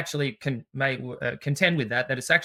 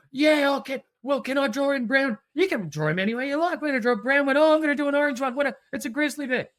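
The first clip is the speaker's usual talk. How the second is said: put-on high, louder, eager kid's chatter; a child bursting to pick a crayon colour; 9 semitones higher, 4 dB louder than usual. Teacher voicing a pupil excited about drawing the bear, high and eager.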